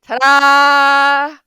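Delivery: loud, drawn-out fanfare; triumphant ta-da. Real, not acted. Winning the argument about ballerino, triumphant.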